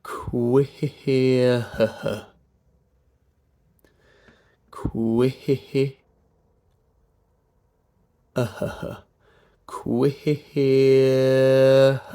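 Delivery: "Queer" drawn out with breath pulses; stretched "Queer", bouncing on each vowel; pulsed diphthong. Shown as a demonstration. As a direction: pulse through both vowel positions of "Queer". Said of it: "then feed through that vowel".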